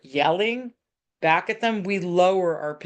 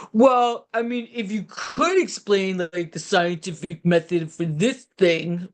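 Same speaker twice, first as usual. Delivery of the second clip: put-on rude teen. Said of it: "he was kind of being rude to me".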